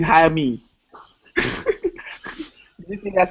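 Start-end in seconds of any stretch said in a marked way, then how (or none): none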